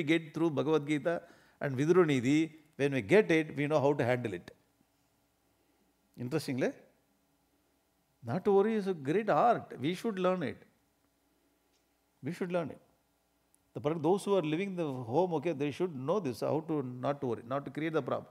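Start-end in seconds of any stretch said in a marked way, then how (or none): none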